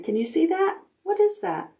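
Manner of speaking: ordinary delivery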